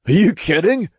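high-pitched imp scoff. A narrator's reading. Imp answering a doubt about his speed, scoffing. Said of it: "the imp scoffed".

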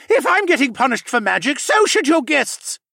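loud, high frenzied protest; frantic, furious shouting; mad frenzy. A narrator's reading. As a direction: rant wildly, dragging out the final demand. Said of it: "continued in a mad frenzy".

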